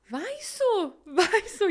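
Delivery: high, eager urging. Retold or imitated. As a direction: push her on, excited. Friend urging her to open coat.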